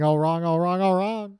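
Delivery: quick-fire version of a quoted line; demonstrating the line at a brisker pace. Shown as a demonstration. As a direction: run the phrases together quickly. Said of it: "if he said it a bit faster".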